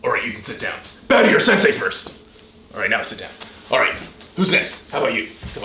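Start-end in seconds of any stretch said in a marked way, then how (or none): 1.1-2.1 higher, louder barked order; sharp martial arts commands. Acted. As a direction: bark it at the student. Sensei barks at student.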